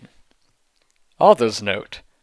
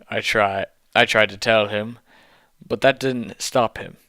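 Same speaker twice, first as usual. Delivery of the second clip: plain reading voice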